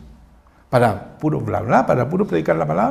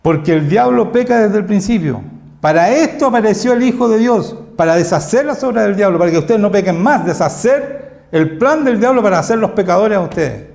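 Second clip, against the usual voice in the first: loud, raised, emphatic preacher's voice; preaching at full force; 5 semitones higher, 8 dB louder than usual. Acted. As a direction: drive each claim home to the congregation.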